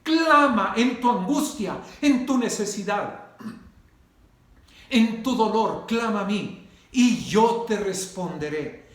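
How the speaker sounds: loud, fervent sermon delivery, each phrase flung out; rising preacher's proclamation, loud and driving; preaching at full force. Acted. Preacher calls on God's promise.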